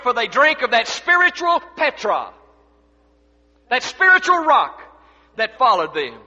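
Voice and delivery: forceful pulpit preaching with weighted key words. Real, not acted. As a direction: preach it out to the whole congregation.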